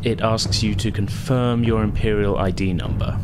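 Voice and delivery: usual speaking voice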